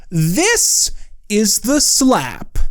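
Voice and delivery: slow, drawn-out announcer read; dramatic title announcement. Acted. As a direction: announce the title like a show intro.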